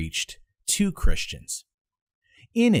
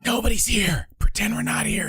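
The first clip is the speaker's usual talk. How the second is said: hushed role voice; 12 semitones higher than usual. Acted. Acted reply from inside.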